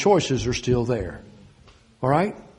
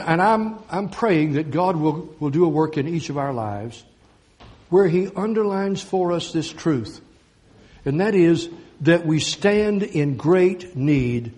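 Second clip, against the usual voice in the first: ordinary talk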